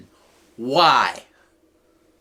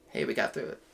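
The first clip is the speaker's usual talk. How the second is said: ordinary talk, nothing marked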